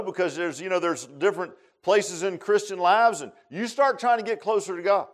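usual speaking voice

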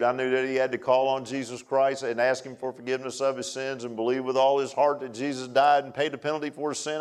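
normal talking voice

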